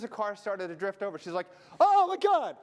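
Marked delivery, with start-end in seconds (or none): 1.8-2.6 high, loud shriek of alarm; panicked shriek. Retold or imitated. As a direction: shriek like the car is about to hit us. Wife panicking at drifting car.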